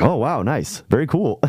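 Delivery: high, forced casual praise; fake cool. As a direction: pretend to be impressed. Fake-cool reaction to possum.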